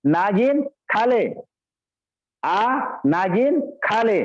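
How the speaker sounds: "नागिन" as one drawn-out word, then "खा ले"; misheard phrasing. Retold or imitated. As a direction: slowly run "नागिन" together, pause, falling "खा ले". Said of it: "पत्नी जो है चिढ़ गई". ("नागिन" एक शब्द, धीरे से "खा ले")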